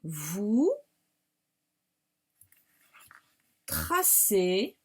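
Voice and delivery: word-by-word dictation with long pauses; each word set apart, rising at the end. Shown as a demonstration. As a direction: dictate slowly, leaving time to write. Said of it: "je vous la dicte après".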